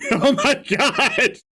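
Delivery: high, slow exclamation through giggles; laughing. Real, not acted. Reader cracking up at the reread scene, laughing exclamation. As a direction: gasp it out laughing.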